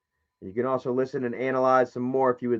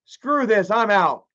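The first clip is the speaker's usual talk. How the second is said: higher, exasperated give-up rant; fed-up dying dad; 8 semitones higher than usual. Pretend. Vlogger pretending to be the dad on his deathbed, fed up. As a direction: done with it all, just get me out. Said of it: "I'd be like".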